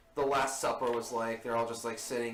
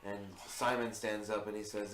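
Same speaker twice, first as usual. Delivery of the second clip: ordinary talk, nothing marked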